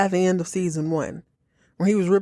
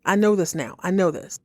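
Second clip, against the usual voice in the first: ordinary talk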